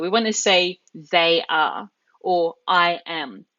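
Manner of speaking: ordinary talk, nothing marked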